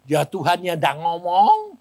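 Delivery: ordinary talk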